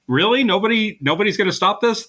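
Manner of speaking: high-pitched mock disbelief; retold incredulous protest. Cop being led out of the station at gunpoint, incredulous. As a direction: imitate a baffled appeal for help. Acting out kidnapped cop's protest.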